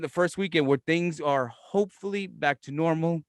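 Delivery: ordinary talk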